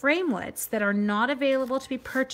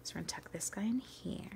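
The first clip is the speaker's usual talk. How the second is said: unvoiced murmured aside to herself, much softer; whisper; 3 semitones lower, 12 dB quieter than usual. Real, not acted. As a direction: drop to a breathy whisper while concentrating. Quiet aside while crafting.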